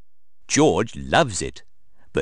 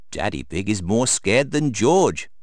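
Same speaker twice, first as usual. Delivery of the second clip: ordinary delivery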